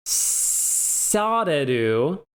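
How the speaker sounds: "sareru" drawn out very slowly, syllable by syllable; slow model word. Shown as a demonstration. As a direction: spell out "sareru" slowly like a teacher. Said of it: "you can take".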